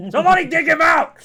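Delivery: high, loud, fast yell; exasperated guard shout. Acted. Guards fed up with the stuck kid, exasperated shout. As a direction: holler the order at full volume. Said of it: "the guards are just like".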